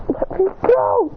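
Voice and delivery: high, straining mock yell; acted struggling yell. Waking chained up in dark chains, struggling yell. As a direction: strain against chains as you cry out.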